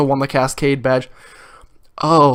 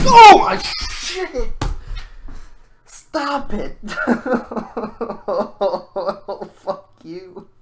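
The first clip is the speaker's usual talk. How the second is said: high laughing shriek; laughing fit; 5 semitones higher, much slower than usual. Real, not acted. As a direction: lose it completely, laughing. Speaker breaks out laughing.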